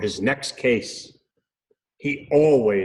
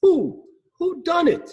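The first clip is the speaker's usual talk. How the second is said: high, hooting who; owl hooting. Acted. Owl detective hooting his question again. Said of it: "Hubert cried".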